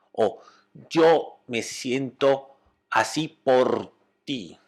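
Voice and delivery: very slow, weighted words; pointed blame. Shown as a demonstration. Trainer demonstrates blaming the other person, slow and pointed. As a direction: spell the blame out slowly, word by word.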